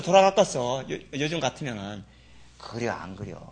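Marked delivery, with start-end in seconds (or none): none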